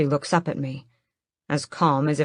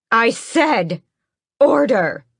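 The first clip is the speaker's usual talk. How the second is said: higher, louder repeated Order!, the word falling hard; exclaiming queen; 6 semitones higher, 5 dB louder, slower than usual. Acted. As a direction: insist on Order! a second time. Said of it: "she exclaims".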